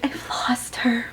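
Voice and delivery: soft, slow whispered grief; heartbroken whisper. Retold or imitated. Alora whispering after losing Hayden, soft and slow. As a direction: breathe it out like a lost lover.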